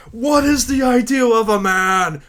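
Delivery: high, loud theatrical shout; hammy dramatic hero. Acted. Titus proclaiming to Yuna, hammed-up shout. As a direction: declaim it like an overwrought stage hero.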